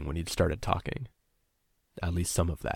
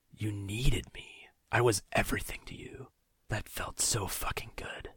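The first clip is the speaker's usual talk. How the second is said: whisper; 3 semitones higher, 5 dB quieter than usual. Acted. Captor leaning over his captive, intimate whisper. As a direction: whisper it close, savouring it.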